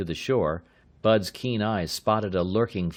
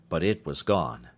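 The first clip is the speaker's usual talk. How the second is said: ordinary delivery, nothing marked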